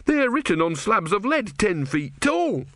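high-pitched indignation; shaking with anger. A narrator's reading. Disciple arguing with his god, high and indignant. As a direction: insist angrily, pitched high. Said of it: "Brother was shaking with anger".

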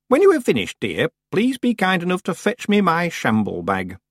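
a hint of a sly old witch. A narrator's reading. Old Miss Treason teases Tiffany, lightly voiced old woman.

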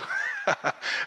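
quick high burst of laughter; laughing at the joke; short high laugh. Real, not acted. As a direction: let a bright laugh slip out. Speaker laughs at the humor.

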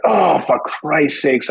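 slow, high, drawn-out groan of embarrassment; mock exasperation. Realizing his dinner guest is Blair, slow and embarrassed. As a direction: stretch it out as the penny drops. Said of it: "And I was like".

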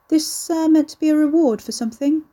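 high, innocent-sounding question; mock innocent. A narrator's reading. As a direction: ask lightly, pitched well up, all innocence.

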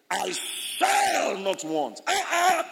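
very slow, drawn-out "I shall not want", stress on "shall"; strong declaration. Shown as a demonstration. Preacher modelling a strong confession, very slow and firm. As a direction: stretch every word firmly. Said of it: "That's a strong word".

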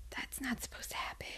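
hushed, quick whisper; voiceless mock-alarmed murmur; whispered retold reaction. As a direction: whisper it like you're caught out in class. Acting own embarrassed aside in class.

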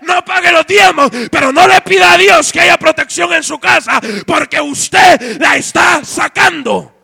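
loud, fervent shouted preaching; a preacher shouting about tithes and God's love; preaching at full force. Acted. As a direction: thunder it at the congregation.

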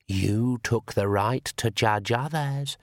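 very slow, eerie accusing voice; shadow voice. Acted. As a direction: drag out the accusation from the dark.

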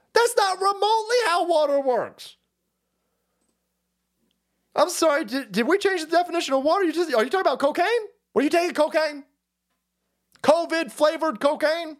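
can't believe what he's hearing; incredulous. Real, not acted. Ranting at Brooke Shields's claim, incredulous high voice.

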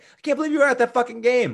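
imitating excited disbelief, high and fast; worked-up disbelief. Movie character reacting to a missed World Series game, worked-up disbelief. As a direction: raise the pitch sharply and rush it, incredulous. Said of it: "Damon finally starts laughing and kinda gets, gets into it".

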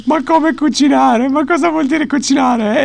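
incredulous host. Retold or imitated. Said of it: "Parenzo stava ammazzando".

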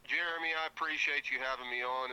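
ordinary delivery

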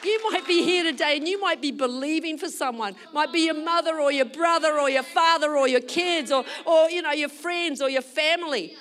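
loud, emphatic exhortation; preaching. Real, not acted. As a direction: urge the congregation with force.

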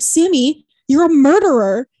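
hammed-up horrified dad scolding; mock accusing dad. Dad scolding the cat after the bird kill, loud mock horror. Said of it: "he's like".

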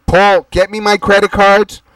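calling across the room. Acted. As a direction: shout to someone in the next room. Calling out to someone offstage.

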